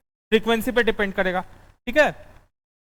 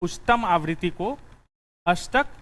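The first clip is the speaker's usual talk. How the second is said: dictating notes. Acted.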